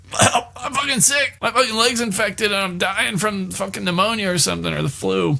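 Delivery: high, complaining, worn-out voice; whiny sick Stu. Acted. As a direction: moan about being sick in a put-on whine.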